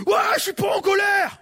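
shrill high yell, denying anger; mock furious shout. Angry person shouting at the pastor, mock furious high yell. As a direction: play the angry person yelling back.

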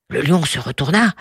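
usual speaking voice

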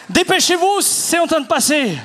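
voix haute, pressante; presse-les avant qu'il soit trop tard; exhortation urgente. Pretend. Presse l'auditoire avant minuit. (urgence pressante, ton aigu)

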